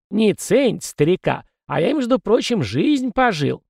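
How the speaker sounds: put-on high, cranky old ghost; a fussy old spirit lecturing. Ghost Kozyrev's voice. (ворчливый старый призрак)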